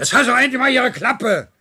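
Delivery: snapping at him to shut up; furious yell; angry outburst. Acted. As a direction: shout it high and loud. Losing temper at annoying man.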